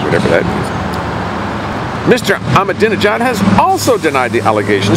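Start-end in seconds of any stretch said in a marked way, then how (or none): 0.0-2.1 slow, breathy throwaway mutter; dismissive mutter. Real, not acted. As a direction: shrug it off under your breath, slowly. Dismissive aside after reading acronym.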